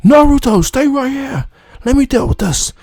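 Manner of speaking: lightly voiced sensei giving an order; voicing the mentor. Jiraiya telling Naruto to stay back, high voiced order. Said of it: "he tells Naruto".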